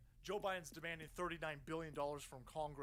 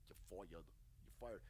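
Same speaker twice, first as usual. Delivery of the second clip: much lower, softer mock catchphrase, a hint of a celebrity boss; Trump; 12 semitones lower, 9 dB quieter, much slower than usual. Host mocks Vindman with Trump's line, lightly voiced Trump. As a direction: drop low and quiet for a lightly voiced firing line. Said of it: "Trump once again".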